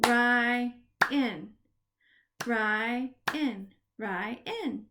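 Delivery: Ryan split into "Ry", pause, "an"; each half of Ryan on its own; syllable by syllable. Shown as a demonstration. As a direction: clap out Ryan in two beats. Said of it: "we are gonna clap the syllable".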